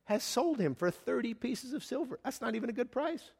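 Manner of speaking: high, hushed disbelief; incredulous. Real, not acted. Preacher on Judas selling out Jesus, incredulous. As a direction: lift the pitch well up while softening the voice.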